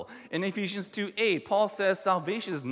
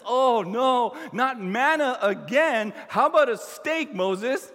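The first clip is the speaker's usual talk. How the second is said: loud, exasperated whining complaint; hammed-up grumbling of an ungrateful crowd; complaining Israelites; 4 semitones higher, 7 dB louder, slower than usual. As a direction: grumble loudly at Moses, pitch lifted. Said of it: "The people complain".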